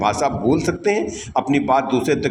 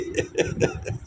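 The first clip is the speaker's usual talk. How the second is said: laugh; 8 dB quieter than usual. Real, not acted. Teacher laughs at own joke.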